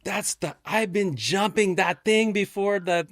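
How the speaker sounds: high, slow put-on accent, brushing it off; same backcountry accent, unbothered; imitating his accent. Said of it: "And he's like".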